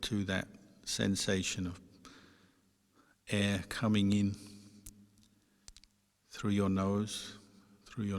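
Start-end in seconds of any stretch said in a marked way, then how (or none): none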